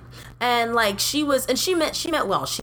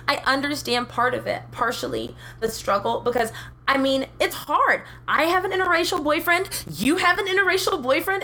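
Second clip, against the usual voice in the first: mock coworker voice; 8 semitones higher than usual. Imitating a coworker bragging about her boyfriend, high-pitched. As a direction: play her like a well-meaning kid. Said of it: "she was like".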